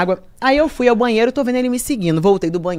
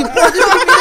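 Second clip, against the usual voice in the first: higher, louder, hammed-up challenge; tough-girl dare; 9 semitones higher, 5 dB louder than usual. Shown as a demonstration. Squaring up to the man who followed her, hammed-up tough dare. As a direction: call him out like a street fighter. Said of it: "aí eu já mudo a postura".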